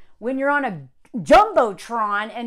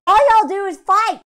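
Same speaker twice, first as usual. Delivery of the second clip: higher and louder, fed up; exasperated outburst; 7 semitones higher, 8 dB louder than usual. Real, not acted. Vlogger venting at the couple's endless fighting, exasperated. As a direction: blurt it out, sick of them.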